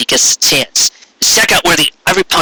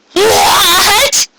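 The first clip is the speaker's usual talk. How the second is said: shocked shout. A narrator's reading. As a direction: yell it in disbelief. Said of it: "the five other ponies in the room demanded".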